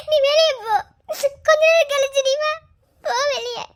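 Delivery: loud, high, pushing him away through tears; sobbing plea. Acted. Wife sobbingly sends her husband out of the room. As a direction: send him out while crying.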